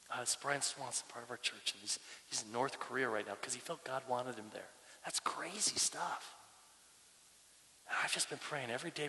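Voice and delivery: very soft, low and sincere; hushed, heartfelt. Real, not acted. Pastor telling church of praying for a friend, hushed and heartfelt. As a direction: confide it quietly, as a burden on the heart. Sharing a heartfelt burden in prayer.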